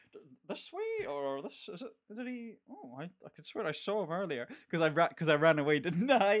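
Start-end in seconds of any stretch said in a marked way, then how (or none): 0.1-4.5 lost and searching, half to himself; confused friend. Retold or imitated. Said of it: "You were like".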